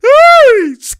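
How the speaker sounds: high, loud burst of laughter; shrieking laugh. Real, not acted. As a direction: cackle out loud at the play. Excited reaction to a play.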